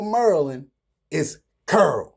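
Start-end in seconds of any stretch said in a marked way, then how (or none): 1.7-2.2 put-on "Curl" for Carol, rhyming with girl; Baltimore pronunciation. Shown as a demonstration. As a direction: say "Curl" in one syllable. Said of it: "in Baltimore".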